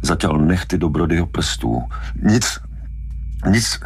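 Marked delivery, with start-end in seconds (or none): 2.2-3.8 higher, choked little denial; barely breathing. A narrator's reading. Hooper with hands round his neck, barely breathing. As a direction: squeeze it out while being throttled. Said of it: "Hooper sotva dýchal".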